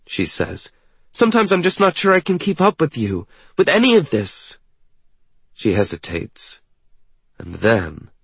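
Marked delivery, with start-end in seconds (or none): 1.1-4.6 high, hurried character voice; self-doubting young woman. Acted. Woman fearing she cannot keep up, anxious. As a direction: pour out worry in a raised pitch.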